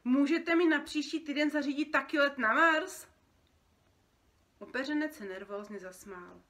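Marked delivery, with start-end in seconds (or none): 0.0-3.1 loud, higher, eager question; excited request. Acted. Boy asking for a flight to Mars, excited. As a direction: ask it bursting with enthusiasm. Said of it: "vydechl nadšeně".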